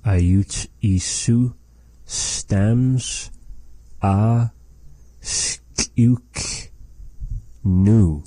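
ayutsisu stams a skliuknu said word by word with long gaps; slow careful repeat. Shown as a demonstration. Teacher repeats the phrase slowly for the learner. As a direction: spell it out slowly, one word at a time. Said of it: "And that is".

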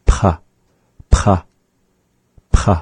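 P, P, P with a sharp puff of breath; breathy isolated p; aspirated p. Shown as a demonstration. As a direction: pop each P with air from the back. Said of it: "carrying that breath with it from the back of the mouth".

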